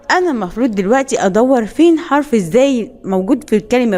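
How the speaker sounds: ordinary talk, nothing marked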